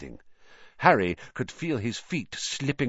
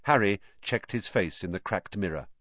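usual speaking voice

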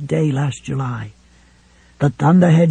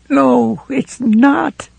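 higher, pleading denial; tearful protest; 9 semitones higher, much slower than usual. A narrator's reading. Helen to Ralph, tearful and rising. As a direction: push back through tears.

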